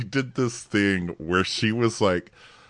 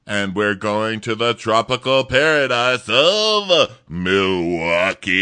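mock host announcement; 6 semitones higher, 7 dB louder than usual. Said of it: "announcer parody".

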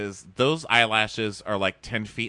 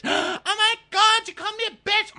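mock scandalized shriek; 6 dB louder than usual. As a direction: parody an offended girlfriend squealing. Acted girlfriend shocked by insult.

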